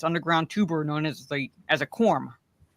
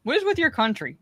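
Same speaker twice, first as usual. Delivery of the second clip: mock exasperation; 8 semitones higher than usual.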